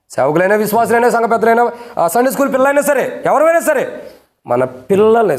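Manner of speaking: preaching at full force. Acted. Pastor rebuking parents.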